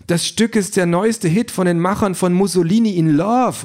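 high, eager exclamation; Sandra bursting with enthusiasm at the display; excited call. A narrator's reading. Thrilled Sandra reads the theatre display aloud. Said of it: "ruft sie begeistert".

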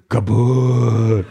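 loud, drawn-out robot word; lumbering combat robot. A narrator's reading. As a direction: boom it out long like a robot.

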